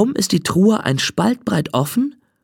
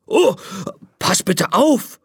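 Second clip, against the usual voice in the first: startled call; 3 semitones higher than usual. Acted. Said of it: "ruft der Geist".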